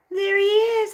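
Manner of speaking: very high, loud squeal of delight. Real, not acted. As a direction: squeal at the dog. Greeting dog high.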